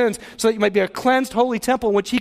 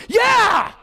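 excited yell; 10 semitones higher than usual. Retold or imitated. Congregant cheering the good news, excited shout. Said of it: "you're going".

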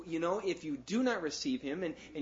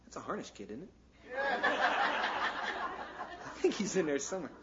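ordinary talk, nothing marked

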